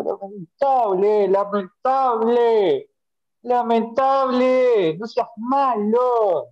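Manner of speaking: each "lamentable" stretched out very slowly; drawn-out lament. Real, not acted. Young fan slowly laments his team's loss to the panel. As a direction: drag out every word in weary despair.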